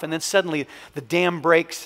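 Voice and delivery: ordinary talk, nothing marked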